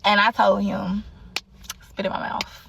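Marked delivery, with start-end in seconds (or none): 1.9-2.6 unvoiced whispered request. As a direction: whisper it softly. Quoting her own request whispered.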